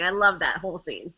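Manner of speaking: ordinary talk, nothing marked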